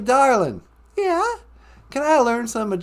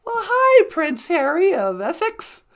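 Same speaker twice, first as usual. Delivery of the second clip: ordinary delivery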